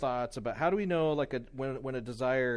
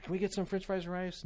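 put-on higher-pitched child's request; imitating a little boy asking; young son asking; 7 semitones higher than usual. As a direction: ask Dad hopefully, pitched up. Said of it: "He's like".

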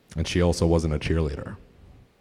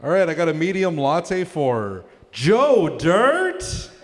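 high, slow barista call; barista calling orders; 10 semitones higher, 4 dB louder, much slower than usual. Pretend. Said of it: "my impression of a good day".

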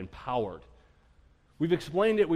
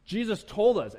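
ordinary delivery

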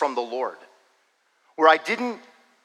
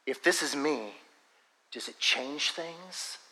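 quiet, slow, gently spaced question; soft divine word; 8 dB quieter, slower than usual. Retold or imitated. Preacher retelling the Lord's word to him, softly and slowly. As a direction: let it fall softly, like a private word.

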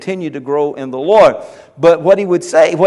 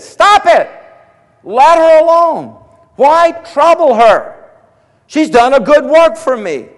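commanding Jesus; 9 semitones higher, 6 dB louder than usual. Acted. Jesus telling the disciples to leave her, commanding. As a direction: proclaim it as Jesus defending her.

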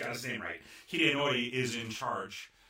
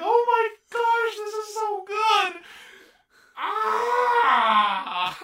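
high, loud, breaking into laughter; laughing hard; 15 dB louder, much slower than usual. Retold or imitated. Viewer cracking up at the butlers chasing the tooth. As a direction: gush through laughter at the comedy. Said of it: "I was laughing so hard".

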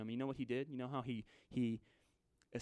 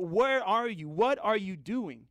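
loud, raised-pitch questioning; desperate demand; 5 semitones higher, 7 dB louder, slower than usual. Acted. Disciples confronting sleeping Jesus in the storm, loud desperate demand. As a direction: demand it loudly, stretching the words.

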